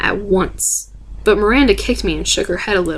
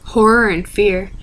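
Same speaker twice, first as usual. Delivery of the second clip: usual speaking voice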